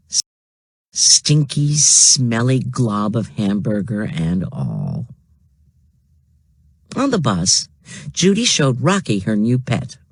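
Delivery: ordinary delivery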